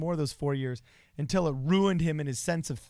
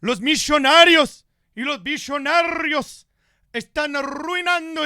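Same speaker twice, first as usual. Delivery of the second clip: Bernie Sanders impression; 11 semitones higher, 8 dB louder than usual. Impersonating Bernie Sanders giving a Spanish stump speech, loud and high. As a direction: rant like Bernie at a rally, in Spanish. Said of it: "this is how Bernie Sanders would speak in Spanish".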